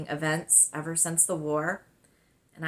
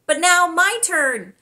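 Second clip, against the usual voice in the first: put-on eager girl's turn; 11 semitones higher, 9 dB louder than usual. Girl claims her turn hugging.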